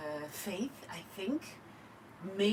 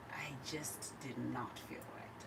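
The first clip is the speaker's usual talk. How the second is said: much softer, lower, nearly breathless; hushed; 7 semitones lower, 12 dB quieter than usual. Real, not acted. Guest recalling the day she felt unwell, hushed. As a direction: drop to a hush remembering the sick morning.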